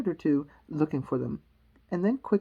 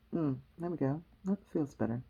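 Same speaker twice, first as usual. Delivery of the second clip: plain reading voice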